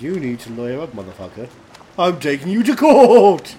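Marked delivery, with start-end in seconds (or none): 2.0-3.5 mock shout. Mock threat to sue cohost.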